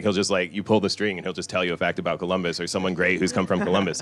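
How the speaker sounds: normal talking voice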